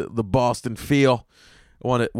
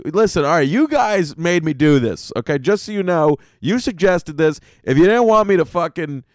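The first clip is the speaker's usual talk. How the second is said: louder, higher warning; mock warning to the hotel; 5 semitones higher, 5 dB louder than usual. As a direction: lay down the law to the room, half joking. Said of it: "I was like".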